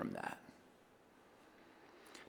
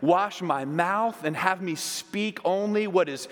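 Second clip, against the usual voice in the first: higher and louder, building into fervent prayer; impassioned plea; 7 semitones higher, 5 dB louder than usual. Pretend. Peter begging Jesus to wash all of him, fervent. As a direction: pour it out, begging to be washed clean. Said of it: "It says, Peter replied".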